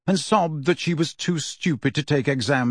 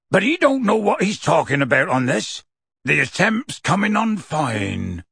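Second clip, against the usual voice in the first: gruff half-giant; 4 semitones higher than usual. A narrator's reading. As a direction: bluster it off gruffly. Hagrid voice.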